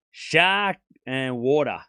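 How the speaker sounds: slow, drawn-out Australian SHARK and WATER; Australian accent. Shown as a demonstration. Showing chat the believable accent, Australian demo. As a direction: spell out each word in an Australian accent. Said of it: "with a believable Australian accent is shark and water".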